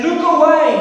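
high, loud urgent cry; screamed warning. Acted. Conscience screaming at David, loud warning shout. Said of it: "to scream at David".

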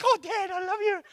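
put-on high, soft little-girl coo; sweet daughter voice. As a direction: coo it with big pleading eyes. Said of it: "With the little eyes".

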